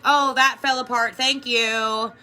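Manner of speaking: loud, breezy, careless thank-you; dismissive thanks. Pretend. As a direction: toss it off without feeling. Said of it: "No, it's like you really have to go inside".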